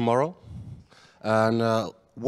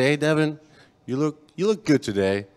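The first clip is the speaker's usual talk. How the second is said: sarcastic; 3 semitones higher, 7 dB louder than usual. Acted. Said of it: "I think that's probably sarcasm".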